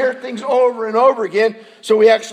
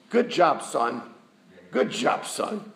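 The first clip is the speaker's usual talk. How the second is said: patronizing; 5 semitones lower, 5 dB quieter, slower than usual. Retold or imitated. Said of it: "Good, gracious words".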